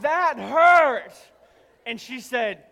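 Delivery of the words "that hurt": higher, louder mock-hurt complaint. Husband telling his wife the pluck hurt, loud mock complaint. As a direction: protest the sting, hamming it up.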